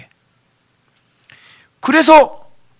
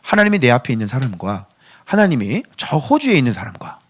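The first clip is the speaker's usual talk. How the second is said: usual speaking voice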